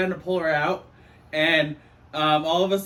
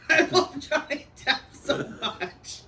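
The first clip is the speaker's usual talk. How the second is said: laughing; 7 semitones higher than usual. Real, not acted. Gushing while laughing.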